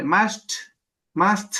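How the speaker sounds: "Masht" cut off on the bare consonant cluster; impronunciable. Shown as a demonstration. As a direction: attempt "Masht" with no vowel after the SHT. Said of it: "eso es impronunciable". ("Masht" impronunciable, cortado en las consonantes)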